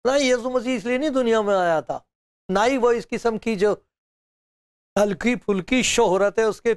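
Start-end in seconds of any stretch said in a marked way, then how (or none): none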